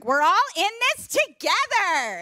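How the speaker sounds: high, chirpy mock instructor slogan; imitating a peppy fitness motto. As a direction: parody a peppy spin coach. Mocking gym instructor slogan.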